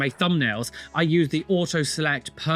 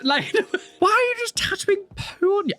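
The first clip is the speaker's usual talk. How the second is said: much higher, laughing while asking; laughing at someone tattooing poo, high laughing disbelief; laughing disgust. Real, not acted.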